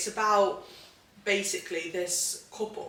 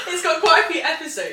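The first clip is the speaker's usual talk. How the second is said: higher, louder, words said through laughter; laughing; 10 semitones higher, 10 dB louder than usual. Real, not acted. Repeating herself to a friend who misheard, laughing. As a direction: giggle the words out.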